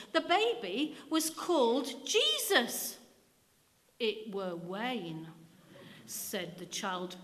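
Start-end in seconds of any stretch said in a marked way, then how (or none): 0.1-3.0 slow, much higher, strained vicar; a vicar firmly correcting the stubborn boy; firm correction. Acted. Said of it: "his voice quavering a little". 4.0-5.3 put-on slow, persuasive child; a persistent boy persuading the vicar; persisting. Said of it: "persuading and persisting".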